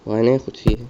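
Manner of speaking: ordinary talk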